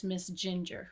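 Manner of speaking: ordinary delivery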